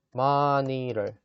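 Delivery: "monitor" very slowly as MAH-ni-der; stressed first syllable. Shown as a demonstration. Teacher demonstrating to learners, slow "monitor" with strong MAH. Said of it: "마가 강세가 있으니까 좀 크게".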